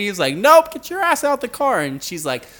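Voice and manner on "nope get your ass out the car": mock order. Cop orders her out.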